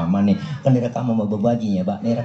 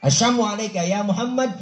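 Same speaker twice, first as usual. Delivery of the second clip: mock sneering curse; 7 semitones higher than usual. The unbeliever cursing the Prophet, sneering. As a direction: curse pitched higher. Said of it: "Kalau kita dikata begitu".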